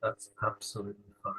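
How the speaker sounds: unhurried body-scan guidance with long pauses; calm meditation guide, leaving space after each phrase; guided meditation. Real, not acted. Leading listeners through a lying-down body scan, guided meditation.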